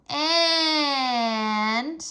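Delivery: long drawn-out and; stretched out word. A narrator's reading. Fudge teasing the table, stretching out the word. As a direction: stretch the word to hold attention. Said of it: "he stretched out the word".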